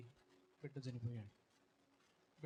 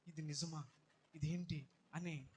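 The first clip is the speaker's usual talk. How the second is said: normal talking voice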